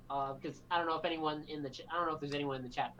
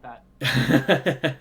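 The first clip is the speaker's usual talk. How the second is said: laughing; 4 semitones lower, 14 dB louder than usual. Real, not acted. Laughs while correcting himself.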